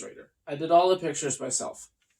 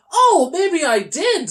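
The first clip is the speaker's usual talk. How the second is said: hopeful high; 11 semitones higher, 4 dB louder, slower than usual. Acted. Toby realizes hopefully.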